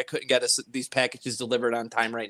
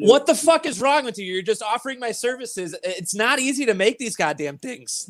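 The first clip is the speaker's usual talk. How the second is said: exasperated; 7 semitones higher than usual. Pretend. Mock outrage at cohost's giveaway.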